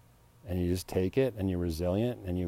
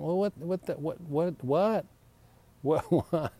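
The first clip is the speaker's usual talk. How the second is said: high, stammered disbelieving whats; incredulous what; 10 semitones higher, slower than usual. Retold or imitated. To airline staff over the fine print, high sputtering disbelief. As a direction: sputter what what in mock outrage.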